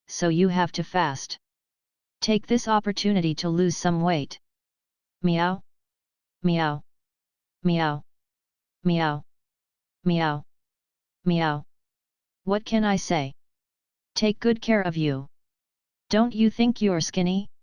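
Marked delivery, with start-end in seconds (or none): 5.2-11.6 sulky cat meows. Acted. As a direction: meow back like a sulky cat.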